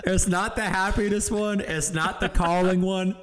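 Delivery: high, fast, laughing through it; cracking up mid-sentence. Real, not acted. Laughing while listing episodes.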